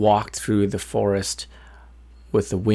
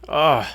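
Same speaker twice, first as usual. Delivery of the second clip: exasperated groan, pitched up; annoyed ugh; 5 semitones higher than usual. A narrator's reading. Sleepless wolf groaning at the bird, annoyed. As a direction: let out a fed-up ugh. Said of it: "This is very annoying".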